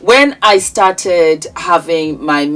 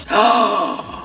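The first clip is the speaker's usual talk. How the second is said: gasp; 4 semitones higher, 4 dB quieter than usual. Real, not acted. Unboxing reveal of the case.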